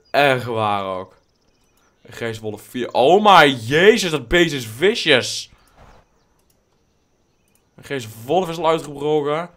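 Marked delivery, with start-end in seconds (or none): 2.9-5.5 loud, high, slow stretched exclamation; drawn-out loud amazement; excited outcry. Real, not acted. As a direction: marvel at the fierce wolf. Reacting to a wild wolf.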